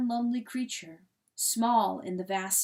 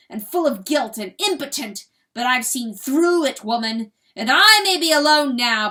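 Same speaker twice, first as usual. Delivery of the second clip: much louder, trembling rage; roaring fury; 4 semitones higher, 12 dB louder than usual. A narrator's reading. Rooster roaring at hen to leave, furious. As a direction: roar it out, shaking with fury. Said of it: "roars the cock".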